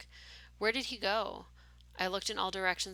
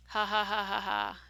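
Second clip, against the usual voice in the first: louder, put-on "ha ha ha" laugh from a masked nurse; eerie nurse laugh; 5 dB louder than usual. Masked nurse laughing at the visitor, eerie put-on laugh. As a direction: laugh it out, strange and detached. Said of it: "Her laughter seemed to come out of the air itself".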